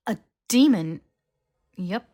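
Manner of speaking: loud high shocked question; alarmed shock. Acted. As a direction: blurt it out in alarm. Hermione alarmed.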